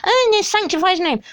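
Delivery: high sing-song pious protest; high, mocking pious chant; mock pious witness. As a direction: parrot a devout Witness, scandalised. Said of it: "nothing like that".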